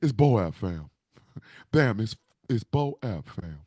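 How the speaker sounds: lightly voiced uncle saying "Boap"; imitating Uncle Dan. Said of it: "Uncle Dan would say Boap a little bit differently".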